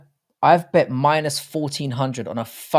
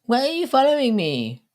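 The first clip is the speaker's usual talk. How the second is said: mock kid voice; 11 semitones higher than usual. Quoting a kid rebuffing his son.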